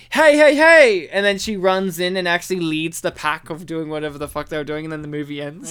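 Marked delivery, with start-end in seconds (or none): 0.1-1.1 loud high Fat Albert call; calling hey. Retold or imitated. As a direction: shout it from off screen. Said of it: "hear her off screen say".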